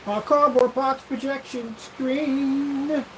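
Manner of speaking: high, slow, stretched announcement; excited announcer. Shown as a demonstration. Showing the exciting way to present the screen. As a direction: sell it like a showman. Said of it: "with some excitement".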